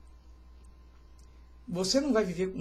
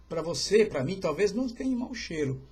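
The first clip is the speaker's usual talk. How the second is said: usual speaking voice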